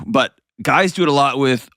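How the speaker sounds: ordinary talk, nothing marked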